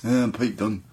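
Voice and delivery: mock Brummie drawl of his own name; a hint of Pete Dunne's Brummie accent. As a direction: lightly imitate his flat Birmingham intro. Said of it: "he's always like".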